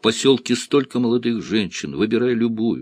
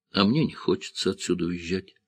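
plain reading voice

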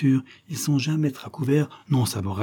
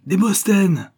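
much higher and louder, a wild cry; frantic shout, voice pitched up high; desperate shout; 10 semitones higher, 6 dB louder, slower than usual. A narrator's reading. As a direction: scream his name, beside herself. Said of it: "criait éperdument Léocadie".

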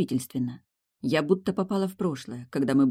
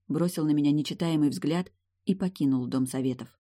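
ordinary delivery

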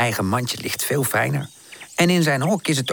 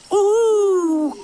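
high, louder drawn-out owl hoot; 5 dB louder than usual. Acted. Owl hoots again.

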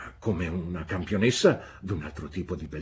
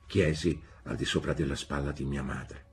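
usual speaking voice

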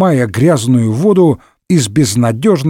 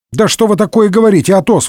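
quick, higher-pitched protest; indignant; 7 semitones higher, faster than usual. A narrator's reading. D'Artagnan rebukes Athos's remark, indignant. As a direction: object hotly, in a rush. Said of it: "возмутился Д'Артаньян".

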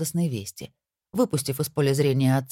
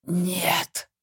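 rasped strained no; hoarse cry; 4 semitones higher than usual. A narrator's reading. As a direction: wheeze it out hoarsely. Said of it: "прохрипел Люцифер".

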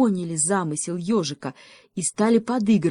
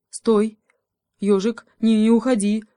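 loud, higher plea; urgent calling; 5 semitones higher, 7 dB louder than usual. A narrator's reading. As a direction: call after someone leaving. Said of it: "бросился ему вслед волчонок". (громко, умоляюще вслед)

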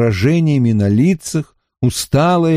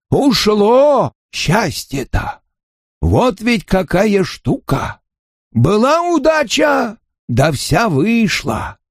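put-on slow, high gummy old man voice; toothless mumbling; 9 semitones higher, slower than usual. A narrator's reading. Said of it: "прошамкал самый старый".

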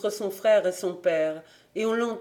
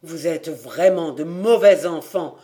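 put-on louder, boiling over at his children; old farmer losing his temper at his children, loud and bitter; angry loud rebuke; 7 dB louder than usual. A narrator's reading. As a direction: let the anger burst out. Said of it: "dit-il tout haut".

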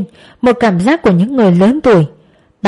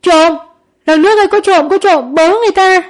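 screaming for help; 10 semitones higher than usual. A narrator's reading. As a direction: scream to the neighbours in terror. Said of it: "bà mới hét lên". (hét lên kêu cứu hoảng loạn)

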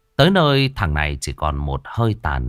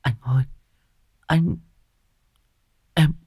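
faint dying gasp; 8 semitones higher than usual. Acted. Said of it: "miệng thều thào".

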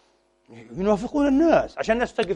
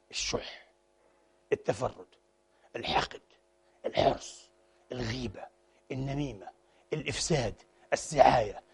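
whispered, much softer word by word; hushed list; 5 semitones lower, 10 dB quieter than usual. Acted. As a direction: breathe each vice darkly. Listing vices in hushed voice. (همس بالرذائل واحدة واحدة)

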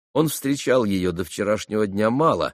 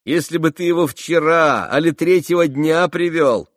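высоко, громко, с восклицанием; задорно попеняй приятелю; оживлённая Грушенька; 8 semitones higher, 8 dB louder than usual. Acted. (взволнованно, с шутливым упрёком)

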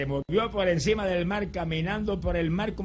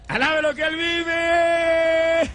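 "Alabe lo que Él vive" shouted much higher and louder, slow; fervent praise shout; 10 semitones higher, 5 dB louder, much slower than usual. Acted. Pastor urging the church to praise, "Alabe lo que Él vive" as a slow loud shout. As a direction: cry out "Alabe lo que Él vive" with all your strength.

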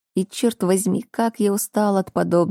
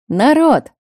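cheerful shout into the flat; loud call; 8 semitones higher, 7 dB louder than usual. Acted. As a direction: call out to everyone, loudly. Brother bursts in calling out.

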